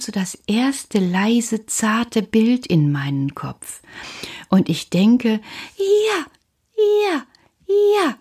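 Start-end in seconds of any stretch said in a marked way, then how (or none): 5.8-8.2 high bursts of joy; excited. Acted. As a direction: squeal each yes with delight. Sudden joyful idea.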